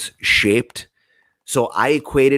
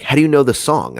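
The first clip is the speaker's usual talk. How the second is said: surprised question; 7 semitones higher, 6 dB louder than usual. Retold or imitated. Friend astonished in the car.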